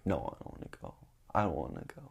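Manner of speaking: low, very soft mumble; imitating a sullen, hushed no; sulky refusal. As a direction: turn it down quietly, dropping low. Acting his sulky refusal.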